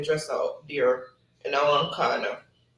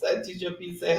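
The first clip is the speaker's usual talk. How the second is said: usual speaking voice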